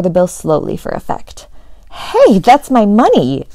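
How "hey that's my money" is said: loud, slow indignant yell. A narrator's reading. Friend yells as his five dollar bill is ripped, loud and slow. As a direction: shout it out over his torn money. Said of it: "yelled Malcolm".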